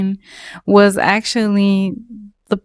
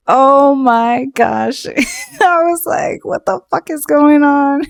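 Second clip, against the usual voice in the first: high, stunned retelling of shock; 6 semitones higher than usual. Retold or imitated. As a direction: pitch it up in disbelief. Shocked at tow scene.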